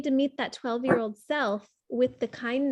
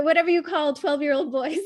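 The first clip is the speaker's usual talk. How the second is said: usual speaking voice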